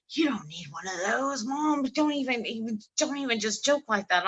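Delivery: son scolding. Retold or imitated. Son tells mom not to joke about cigarettes, scolding high voice. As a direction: scold her like a worried son.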